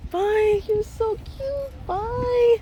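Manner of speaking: very high, drawn-out sweet goodbye. Real, not acted. Saying bye to the cute doggy, baby talk. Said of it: "Now I'm a little girl".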